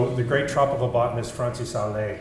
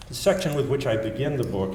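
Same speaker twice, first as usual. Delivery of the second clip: normal talking voice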